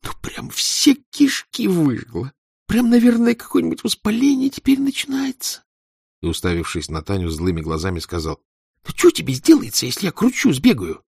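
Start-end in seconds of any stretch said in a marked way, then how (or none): 0.0-5.6 high strained whining; anguished boy. Acted. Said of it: "с надрывом шептал". 8.9-11.0 put-on pleading boy. Angry boy begging Tanya.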